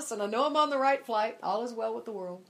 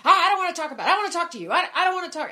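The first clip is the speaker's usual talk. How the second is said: higher, louder, hurried brush-off; flustered refusal; 7 semitones higher, 10 dB louder, much faster than usual. Retold or imitated. Stuck in the window seat, she flusters off the stranger, loud. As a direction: bat the topic away in a nervous rush. Dodging seatmate's rapture talk.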